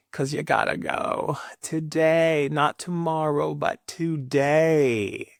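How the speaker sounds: drawn out, stressing today; slow emphatic today; slow pointed emphasis. Acted. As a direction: lean on today, slowly. Quoting someone slowly, pointed.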